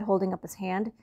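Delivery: ordinary delivery, nothing marked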